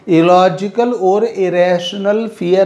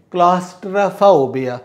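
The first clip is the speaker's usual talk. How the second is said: slow pronunciation drill; slower than usual. Shown as a demonstration. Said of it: "teacher drilling pronunciation".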